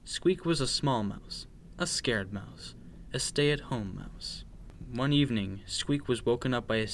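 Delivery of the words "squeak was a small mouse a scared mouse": ordinary delivery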